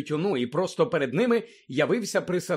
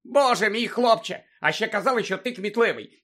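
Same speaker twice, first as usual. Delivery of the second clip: higher, exasperated scolding; impatient місіс Фік; 5 semitones higher, 4 dB louder than usual. A narrator's reading. Old woman chiding the boy, impatient. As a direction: chide as if it were obvious. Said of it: "нетерпляче відказала місіс Фік".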